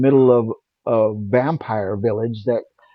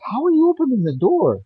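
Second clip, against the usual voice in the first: retold amazement; 12 semitones higher than usual. Retold amazed question at door.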